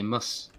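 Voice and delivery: "mus" with a short uh vowel for miss; South African "mus". Shown as a demonstration. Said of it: "if you want to say miss in South African".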